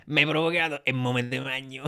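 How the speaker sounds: laughing Roman film-comic voice; Alberto Sordi. Retold or imitated. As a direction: throw out a Sordi line, laughing. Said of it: "Potresti parlare italo-americano all'Alberto Sordi?".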